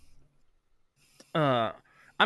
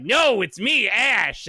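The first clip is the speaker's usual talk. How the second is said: high, drawn-out fake Southern voice; mock Southern protest, sung out high; fake Southern accent; 8 semitones higher, 4 dB louder, slower than usual. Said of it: "this really fake Southern accent".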